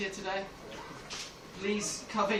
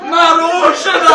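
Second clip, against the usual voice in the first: panicked warning yell; 7 semitones higher, 18 dB louder than usual. Acted. Climber warning people below of a falling rock, yelling desperately. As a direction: bellow it down to people far below.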